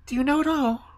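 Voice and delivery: put-on high, urgent little-girl voice; pleading high-pitched questions; crying out. A narrator's reading. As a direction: ask anxiously in a very high child's voice. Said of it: "cried Gerda".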